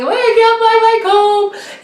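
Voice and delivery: high, loud sing-song sob over a lost comb; mock crying wail. Young wife wailing to husband about her lost comb, mock sobbing. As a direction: wail it like a little kid who lost a toy. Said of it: "and I'm crying".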